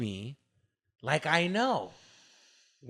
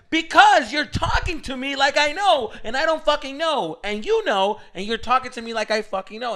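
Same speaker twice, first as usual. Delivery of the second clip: high-pitched acted-out rant, easing down; irritated; 9 semitones higher, 5 dB louder than usual. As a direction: argue back, re-enacting the irritation. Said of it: "and that irritates me".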